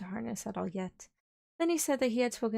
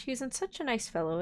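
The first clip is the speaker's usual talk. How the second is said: ordinary delivery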